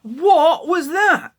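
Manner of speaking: high, loud and slow startled shout; alarmed cry. Acted. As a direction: shout out in fright as the ship lurches. Ship lurches suddenly, alarmed boy.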